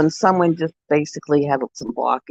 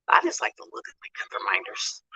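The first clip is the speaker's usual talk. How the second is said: whisper; 7 semitones lower, 9 dB quieter than usual. Real, not acted. Quiet aside to camera.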